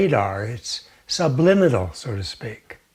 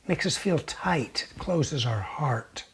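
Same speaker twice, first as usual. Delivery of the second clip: hushed whisper; 2 semitones lower, 8 dB quieter than usual. Acted. Teacher describing a closing heart, whispered. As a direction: whisper it softly, slowing down.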